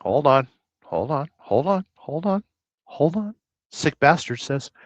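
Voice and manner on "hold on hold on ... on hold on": rising sing-song hold on; climbing repeats. Real, not acted. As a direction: step it up the scale.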